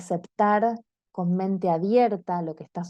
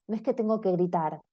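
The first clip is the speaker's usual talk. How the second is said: ordinary talk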